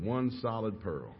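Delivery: drawn-out, awed emphasis; slow emphatic repeat. Retold or imitated. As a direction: let "one solid pearl" land slowly.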